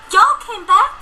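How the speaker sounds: high delighted little filly; amazed filly. Acted. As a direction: ask in happy disbelief. Filly amazed family came.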